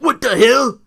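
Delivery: high, loud mock outburst; Booker T impression. Imitating the commentator's outburst, high and loud. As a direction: exclaim it loudly in a high put-on voice. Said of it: "he's like".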